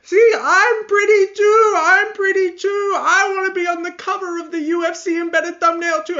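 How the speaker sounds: loud, very high mock wailing; Werner Jandiroba. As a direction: wail it in a squeaky put-on cry. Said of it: "starts crying and literally flashes".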